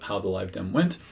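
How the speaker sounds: ordinary delivery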